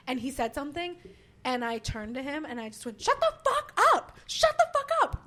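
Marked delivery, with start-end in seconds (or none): 3.0-5.2 screaming it, high and loud; furious yell. Retold or imitated. Comic yelling at a heckler mid-set, full scream. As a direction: lose it completely on the heckler. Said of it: "I yelled at him like I had never yelled".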